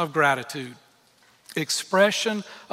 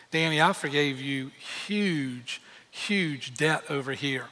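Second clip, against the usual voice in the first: softer, slow, lower tender rebuke; gentle voice of God; 3 semitones lower, 5 dB quieter, slower than usual. Pretend. God reminding Danny of his forgiven debt, soft and slow. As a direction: speak as a patient loving father. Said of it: "what God says to me".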